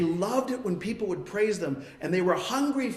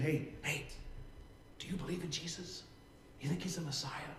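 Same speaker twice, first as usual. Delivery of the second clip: much softer, hushed question; whisper; 12 dB quieter than usual. Retold or imitated. Questioner in a quiet corner, whispering. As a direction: lean in and ask secretly. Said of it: "Why are we whispering?".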